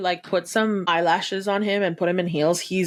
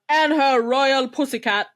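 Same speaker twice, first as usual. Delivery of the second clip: grand announcer; 4 semitones higher than usual. Retold or imitated. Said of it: "he goes".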